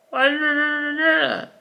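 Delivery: usual speaking voice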